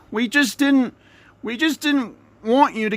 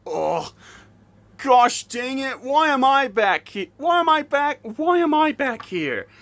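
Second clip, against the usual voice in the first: usual speaking voice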